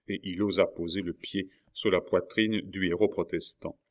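usual speaking voice

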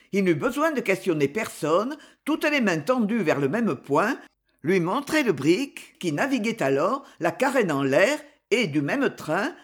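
ordinary delivery, nothing marked

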